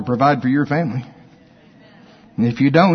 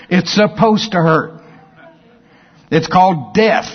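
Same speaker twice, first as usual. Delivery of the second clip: ordinary talk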